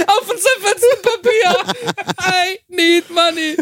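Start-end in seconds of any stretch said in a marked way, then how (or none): 2.2-3.6 high, slow, laughing English; mock imam impression. Mocking the imam's slow plea for money. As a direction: mimic him begging for money. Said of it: "Der hat geredet wie".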